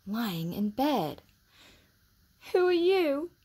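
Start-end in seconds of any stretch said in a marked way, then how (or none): 2.5-3.3 put-on high, loud sulky boy voice; a tearful sickly boy challenging; sniffly boy. A narrator's reading. Colin sniffing at Mary in his room. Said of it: "he sniffed".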